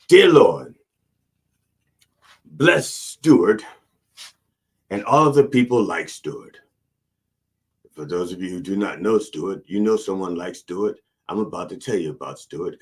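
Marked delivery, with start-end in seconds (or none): 0.1-6.6 booming preacher's prayer, much louder; loud mock prayer. Preacher praying aloud for Stuart, booming mock prayer. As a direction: call out to the Lord like a pulpit preacher.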